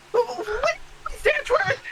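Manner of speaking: character voice. Acted. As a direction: pitch way up into a silly squeak. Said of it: "don't fuck me over with my voice".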